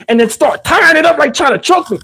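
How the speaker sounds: ordinary talk, nothing marked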